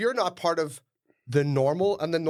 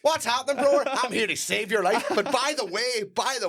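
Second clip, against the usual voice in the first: mock influencer; 7 semitones higher than usual. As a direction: play the grinning savior for the camera. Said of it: "influencer bit continues".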